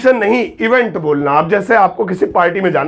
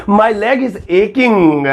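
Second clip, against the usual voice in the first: my leg is aching said very slowly and carefully; slow corrected sentence; much slower than usual. Shown as a demonstration. Teacher correcting his own slip with the right sentence, slowly. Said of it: "गलत बोल दिया".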